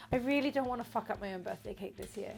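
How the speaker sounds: ordinary delivery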